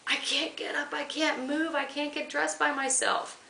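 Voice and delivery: a mild mock scream pitched high; retold scream. Patient recalling her plea to the officer, high mock scream. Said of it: "And I screamed".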